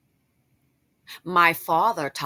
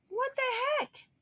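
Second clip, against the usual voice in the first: very high, drawn-in mock shock; shocked disbelief; 6 dB quieter than usual. Father reads the paternity test result, shocked. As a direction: squeal it softly in stunned disbelief.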